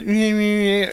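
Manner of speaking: high-pitched taunting meh noises; mocking mimicry. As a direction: mimic him in a high, bratty babble. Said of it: "I mimicked him".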